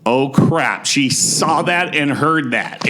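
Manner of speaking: ordinary talk, nothing marked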